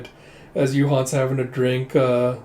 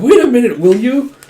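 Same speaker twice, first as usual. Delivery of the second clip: loud, high call to stop her; 10 semitones higher, 10 dB louder than usual. Retold or imitated. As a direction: shout after her before she goes. Calling after her to stop.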